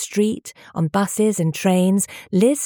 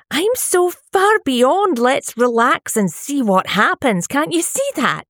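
much higher, slow exasperated pleading; arguing; 9 semitones higher, 4 dB louder than usual. A narrator's reading. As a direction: vent her frustration at him. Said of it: "she'd argued".

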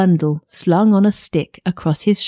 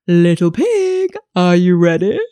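wolf calling up sweetly. A narrator's reading. Wolf calling for the pig at six.

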